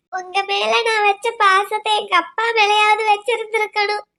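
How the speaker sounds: normal talking voice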